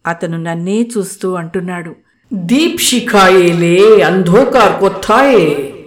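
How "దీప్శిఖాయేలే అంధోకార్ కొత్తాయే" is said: Bengali poetic line. Acted.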